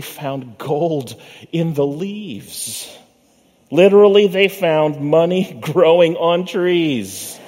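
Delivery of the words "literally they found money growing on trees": laughing. Real, not acted. Laughing at the punchline.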